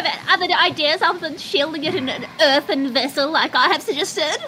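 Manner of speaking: high-pitched, pointed in-character complaint; indignant woodland character. Acted. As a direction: press your rejected idea on the group, pitched high. Player voices indignant character.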